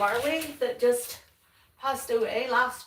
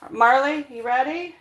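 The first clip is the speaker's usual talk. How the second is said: louder, higher call to the dog; 5 semitones higher, 9 dB louder than usual. Real, not acted. Calling the dog.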